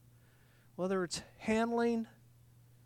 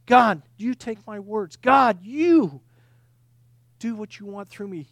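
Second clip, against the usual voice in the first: loud, emphatic plea to God; fervent prayer; 9 dB louder, slower than usual. Acted. Preacher models saying yes to God, fervently. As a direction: call out to God with full conviction.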